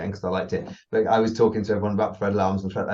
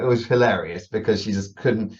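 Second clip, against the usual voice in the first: usual speaking voice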